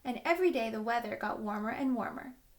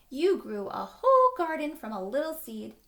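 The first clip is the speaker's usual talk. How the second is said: proud praise; 4 semitones higher, 7 dB louder than usual. Acted. Adult praising the girl.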